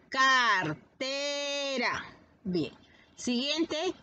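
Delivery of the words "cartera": "Cartera" very slow, car, te, ra; slow teacher's "Cartera", syllables held long; drawn-out syllables. Shown as a demonstration. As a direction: stretch each syllable of "Cartera". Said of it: "Tiene tres sílabas".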